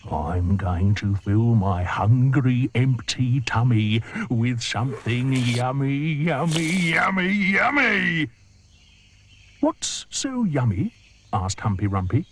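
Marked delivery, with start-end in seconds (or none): 0.1-8.3 slow gloating that climbs higher; greedy crocodile. Acted. The crocodile gloating to the hippo about lunch, greedy. 9.6-10.9 much higher, slow puzzled question; curious hippo. Acted. Humpy Rumpy puzzled by the crocodile, high and curious.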